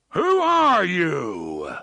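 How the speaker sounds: loud, slow booming giant roar. A narrator's reading. Big Blaine roaring at intruders, slow loud boom. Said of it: "a voice roared".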